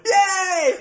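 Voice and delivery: high loud yay; cheer. Retold or imitated. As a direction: shout a happy yay. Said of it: "I was gonna go".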